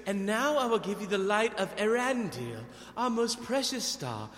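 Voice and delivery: lightly voiced solemn elf queen; a hint of a grand elf queen bestowing a gift; Galadriel. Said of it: "she goes".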